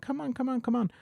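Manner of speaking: high, coaxing come-along voice; beckoning little hand. Pretend. The oracle tool beckoning the player onward: high coaxing call. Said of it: "like, little hand".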